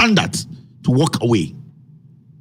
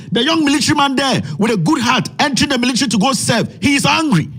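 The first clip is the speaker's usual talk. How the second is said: building from frustrated to a near-shout, higher with each line; rising anger; 5 semitones higher than usual. Real, not acted. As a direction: pile up the outrage until you're almost yelling at them. Heated rant about frustrated youth.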